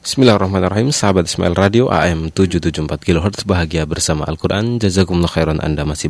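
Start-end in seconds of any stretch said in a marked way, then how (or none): none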